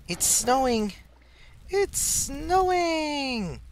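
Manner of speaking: very high, stretched-out "It's snowing!"; high, slow mock cry; high drawn-out cry. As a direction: sing out the news, slowly and high. Repeating snowing, second drawn out.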